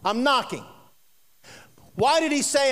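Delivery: usual speaking voice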